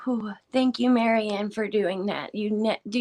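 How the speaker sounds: ordinary delivery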